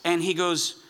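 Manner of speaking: ordinary talk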